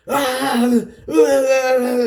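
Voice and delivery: high wailing "ah" of a cartoon outburst; Tasmanian devil outburst. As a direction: mimic a wild Tasmanian devil fit. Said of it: "yeah, Tasmanian devil".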